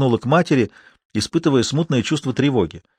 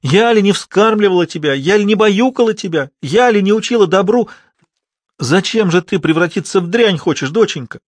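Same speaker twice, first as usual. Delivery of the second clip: anguished keening mother, acted; wailing at full voice; 8 semitones higher, 4 dB louder than usual. A narrator's reading. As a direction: wail in desperation, stretching the cries. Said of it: "взвыла во весь голос".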